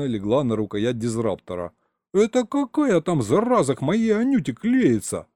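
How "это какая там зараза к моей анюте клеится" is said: high-pitched, fuming demand; jealous indignation. Acted. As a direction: demand to know who the rival is. Threatening whoever courts his Anyuta.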